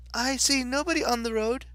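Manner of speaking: high-pitched little Alice voice; Alice, polite. Acted. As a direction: answer in a high girlish Alice voice.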